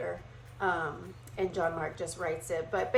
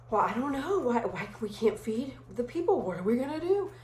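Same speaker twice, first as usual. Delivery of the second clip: higher, whiny disciples at a loss; confused disciples; 4 semitones higher than usual. Retold or imitated. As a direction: pitch up, helpless and fretting. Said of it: "they're like".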